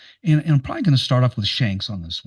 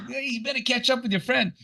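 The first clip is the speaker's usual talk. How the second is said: high, softer mock Australian call; Australian heckler accent; 10 semitones higher, 6 dB quieter than usual. Retelling the beach heckler's call, in a lighter Australian accent. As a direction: repeat the heckle in broad Australian, a little softer. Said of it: "one guy yells out to me".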